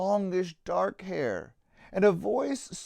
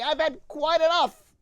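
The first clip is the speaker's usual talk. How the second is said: gruff, raised and higher, fed up; with a huff; 8 semitones higher, 4 dB louder than usual. A narrator's reading. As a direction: snap it out like a mean, tough owner. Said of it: "said with a huff".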